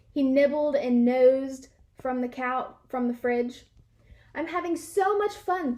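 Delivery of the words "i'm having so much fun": higher, gleeful inner voice; bubbling with joy to himself; delighted thought. A narrator's reading. Dog alone at home, gleeful and higher. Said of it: "thought Stanley".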